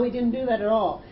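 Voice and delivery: ordinary talk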